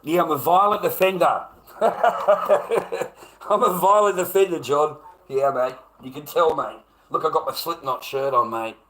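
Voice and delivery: loud and high, laughing sarcasm; mock the accusation, loud and laughing; loud laughing sarcasm at the trolls; sarcastic mockery. Real, not acted. Sarcastically mocks the violent-offender claim.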